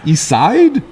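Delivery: tossing off "East Side?" the way Shaker Heights locals say it; Shaker Heights accent. Retold or imitated. Host showing how Shaker Heights people say it, light Shaker Heights accent. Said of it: "that's how they say it up there".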